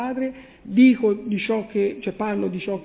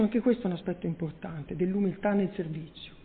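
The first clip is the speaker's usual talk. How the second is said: usual speaking voice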